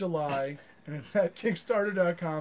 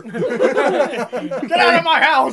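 put-on loud, high angry old man; angry yell; 6 semitones higher, 9 dB louder than usual. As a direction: shout him out of the house. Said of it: "This is our impression of Al".

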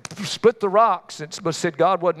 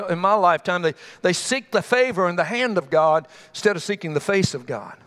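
normal talking voice

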